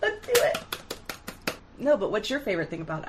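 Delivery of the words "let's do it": eager yes. Real, not acted. Eager agreement.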